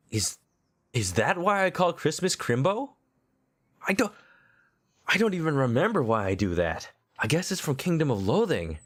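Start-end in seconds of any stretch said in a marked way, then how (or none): none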